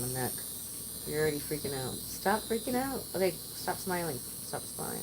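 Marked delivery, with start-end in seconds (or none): 4.2-5.0 hushed, breathy repeat; whispered. Real, not acted. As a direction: drop to a whisper. Repeats order, second time whispered.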